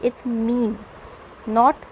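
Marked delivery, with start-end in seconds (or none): 0.3-0.7 मीम said as one long meem syllable; मीम rhyming with team; correct meme. Shown as a demonstration. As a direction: model मीम softly as meem. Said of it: "how we pronounce this word".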